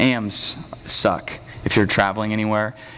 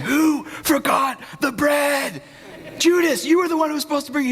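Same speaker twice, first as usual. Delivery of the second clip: high-pitched squabbling accusation, hammed-up; mock bickering. Disciples fighting over forgotten bread, mock bickering. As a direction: play an indignant disciple blaming another, voice way up. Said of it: "fighting each other".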